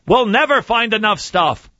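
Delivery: ordinary talk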